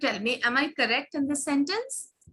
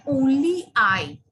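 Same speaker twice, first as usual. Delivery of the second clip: "only I" slow and louder, falling; stress on only; 6 dB louder, slower than usual. Shown as a demonstration. Teacher to class, stressing "only I" loud and slow. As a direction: punch "only I" slowly. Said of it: "when I say".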